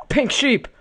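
very high, loud burst of excitement; excited shout. Real, not acted. Player spotting a rare pink sheep, excited shout.

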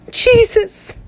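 a sudden high cry of terror; frightened cry. A narrator's reading. Grandmother crying out in fear at the gunshots. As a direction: gasp the name out, high and scared. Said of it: "the old lady cried".

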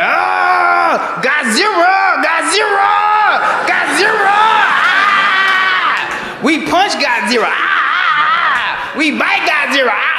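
high screaming terror in a mock Japanese accent; drawn-out Japanese-accented shrieks. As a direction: cry out like a monster-movie victim, Japanese accent. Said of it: "I do the accent because she's from Japan".

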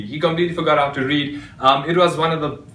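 ordinary delivery

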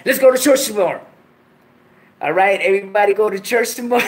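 loud churchy call to the crowd. Shown as a demonstration. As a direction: shout it out, about to crack up. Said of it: "I can't even say it with a straight face".

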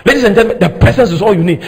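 ordinary talk, nothing marked

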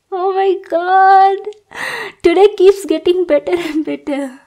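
high, loud squeal of joy; giddy delight. Acted. Girl thrilled the intruded-on boy finds her cute, squealing. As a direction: gush like a lovestruck fan.

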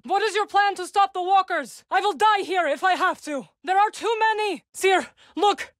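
high, tense pleading; high-pitched alarm as the walkers close in; desperate urgency. Acted. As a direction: push through rising panic. Desperate battle, walkers approaching.

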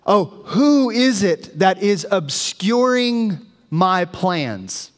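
intense, slow, imposing voice of God. Retold or imitated. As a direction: confront him slowly, drawing out the words. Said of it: "it's intense".

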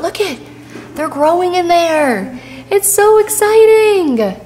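slow, high and thrilled; excited. Real, not acted. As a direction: gush over the crystals. Excited about crystals.

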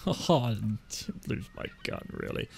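soft, slow, trailing-off lie; half-hearted denial. Acted. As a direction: let the denial shrink away quietly. Second, half-hearted lie about gun.

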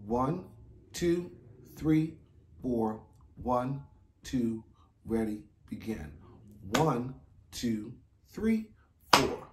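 steady count-in with a cue. Shown as a demonstration. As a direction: count them in evenly. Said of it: "Here we go".

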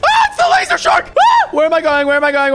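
panicked screaming. Real, not acted. Player screams as the laser shark escapes. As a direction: shriek as the shark gets loose.

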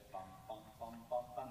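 a run of bouncing "bum" syllables; jumping note imitation. Shown as a demonstration. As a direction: voice the notes as little separate jumps. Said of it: "like jumps".

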